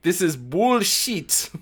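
high, drawn-out mock Russian accent; attempted Russian accent. Angry exchange student complaining, hammed-up Russian accent. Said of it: "trying to read this phonetically to get the Russian accent".

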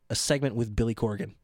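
ordinary talk, nothing marked

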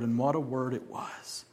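ordinary delivery

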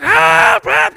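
high, very loud mimicked yell; repeated urgent shouts of a man in trouble; shouted cry for help. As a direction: holler as if trapped down below. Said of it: "Kok dia teriak".

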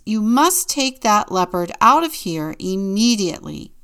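loud, emphatic order; urgent announcement. A narrator's reading. Pediatrician orders the leopard out of his office, loudly announced. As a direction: order them out, loud and firm. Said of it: "he announced".

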